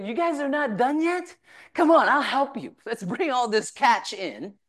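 rally the crew to haul in; eager call. Retold or imitated.

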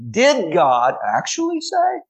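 devil's sly question. Retold or imitated. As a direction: tempt with a high, drawn-out sneer. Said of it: "He says".

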